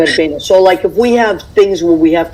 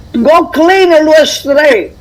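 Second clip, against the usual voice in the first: higher, louder, laughing mock order; family elders' order; 8 semitones higher, 4 dB louder, slower than usual. Woman imitates relatives ordering the kids to clean the ashtray, laughing. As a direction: bark it like the smoking uncles did, laughing. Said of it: "So it'd be like".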